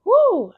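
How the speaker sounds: high, loud "woo"; excited whoop. Real, not acted. Host welcoming viewers to the series, excited whoop. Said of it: "I'm so excited that you're here".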